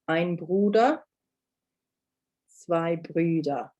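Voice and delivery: slow, clear Bruder then Brüder; repeat-after-me model. Shown as a demonstration. Teacher leading repetition, slow and careful. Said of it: "try and say them after me".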